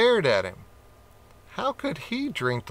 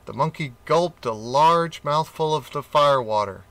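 usual speaking voice

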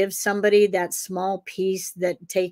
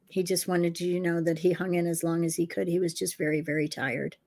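soft, hushed relay; 2 semitones lower, 8 dB quieter than usual. Retold or imitated. Relays husband's message to widow.

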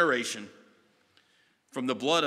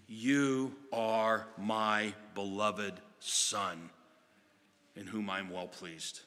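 very slow, softer, fading away; solemn voice from heaven; 5 semitones lower, 5 dB quieter, much slower than usual. Acted. God the Father over Jesus at baptism, very slow and solemn. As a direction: speak it like a blessing from above.

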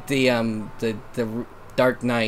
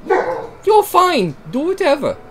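much higher, hammed-up British read; high British accent; 14 semitones higher, 5 dB louder than usual. Rereading Shane's line in a high British voice. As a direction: pitch it up into a posh Brit. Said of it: "offended by your British accent".

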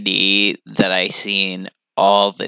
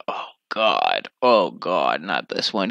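mock dread; 5 semitones lower, 4 dB quieter than usual. Mock groan at the movie.

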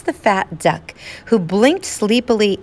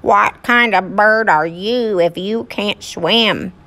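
quacking duck voice, slow; squeaky rubber duck; 2 semitones higher, slower than usual. Acted. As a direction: quack it back at the bird. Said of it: "the duck quacked back".

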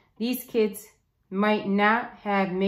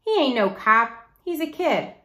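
put-on higher, louder scoffing kid; snickering mockery; 5 semitones higher, 4 dB louder than usual. A narrator's reading. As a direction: scoff at the idea like it's silly. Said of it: "Piper snickered".

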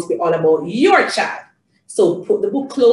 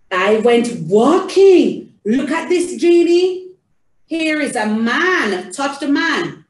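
animated storytime voice with stretched key words; theatrical reading to a child; 4 semitones higher, 3 dB louder, slower than usual. Shown as a demonstration. Showing parents how to read to Janie, theatrical. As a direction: read it out big for a little one.

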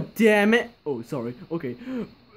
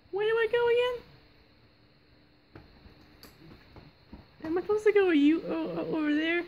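squeaky high puppet voice; high character voice; 13 semitones higher, faster than usual. Acted. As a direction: pitch way up for the character. Character voice in game roleplay.

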